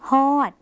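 ฮอด read out with a long, drawn vowel; shown pronunciation. Shown as a demonstration. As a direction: sound out ฮอด clearly for learners. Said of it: "คุณผู้ชมก็จะอ่านว่า". (อ่านคำว่า ฮอด ให้ผู้เรียนฟังชัด ๆ)

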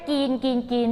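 กรีน without its R, just "geen"; simplified G-plus-een for กรีน; mispronounced green. Shown as a demonstration. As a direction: drop the R from กรีน, repeating it. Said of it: "บางคนออกเสียงแค่".

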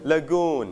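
lagoon with the weight on goon, la kept light; stress on second syllable. Shown as a demonstration. Teacher models la-GOON for the class, stress on the second syllable. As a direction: say la-GOON, never LA-goon. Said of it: "เราเน้นพยางค์ที่สองเป็น lagoon".

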